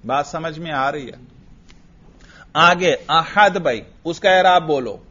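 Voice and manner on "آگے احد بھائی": normal talking voice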